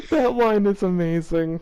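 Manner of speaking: high, breathless laugh sliding into the words; helpless laughter. Real, not acted. Reacting to the fanfic line with a friend, cracking up. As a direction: lose it, then squeeze out the comment.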